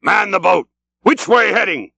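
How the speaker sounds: high, urgent shouted order; Ahab roaring. A narrator's reading. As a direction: call out commands to the crew. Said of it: "roared Ahab".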